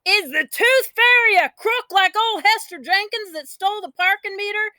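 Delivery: high, shouting indignant question; outraged shout. A narrator's reading. Child Orme shouting his outraged question at Mama. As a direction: demand it in a burst of outrage. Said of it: "I exploded".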